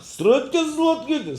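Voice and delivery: high, loud Ukrainian gibberish; mock yelling. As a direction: imitate his uproar at full pitch. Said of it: "Keule, Keule gemacht". (נאכמאכן זיינע קולות)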